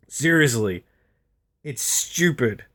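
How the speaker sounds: normal talking voice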